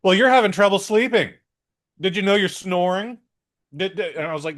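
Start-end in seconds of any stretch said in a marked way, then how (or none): 0.0-3.2 louder, higher quoting the doctor. Retold or imitated. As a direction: play the doctor a bit brightly. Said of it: "you're gonna be like".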